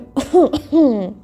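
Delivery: a pointed, deliberate little cough to interrupt; staged attention-getting cough; fake cough. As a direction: clear the throat on purpose to break the moment. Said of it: "थोड़ी बात करती है".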